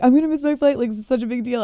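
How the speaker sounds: high-pitched frantic complaint; worked-up retelling of a panicked caller; retold panic. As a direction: plead in a mock panic. Retelling her panic on the phone.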